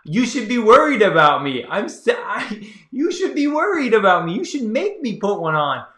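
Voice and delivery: building from a disbelieving question to a high, loud protest; incredulous outburst. Retold or imitated. Telling women who skip protection, building from disbelief to a high, loud exasperated protest. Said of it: "And I'm just like".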